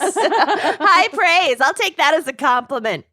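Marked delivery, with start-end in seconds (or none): 0.0-1.6 laughing. Real, not acted. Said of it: "The silence".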